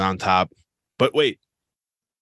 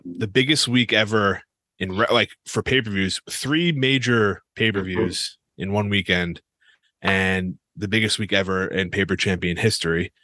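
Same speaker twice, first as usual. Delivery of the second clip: usual speaking voice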